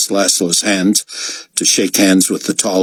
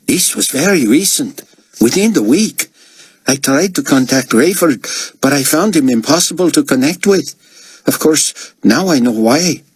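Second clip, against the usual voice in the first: Albi's voice; 8 semitones higher than usual. Acted. Albi explains, held character voice.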